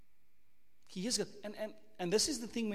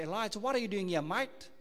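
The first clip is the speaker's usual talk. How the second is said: put-on quick, light Australian accent; a hint of Australian, rattled off fast. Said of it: "If you're from Australia, God will say".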